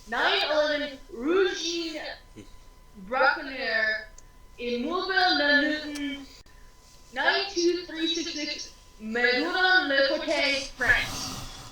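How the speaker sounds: slow careful attempt at French address; halting stab at a French accent. Shown as a demonstration. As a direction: sound out the French words slowly. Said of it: "I'd like to try to pronounce".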